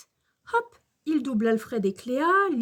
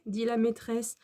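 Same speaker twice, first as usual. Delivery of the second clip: ordinary delivery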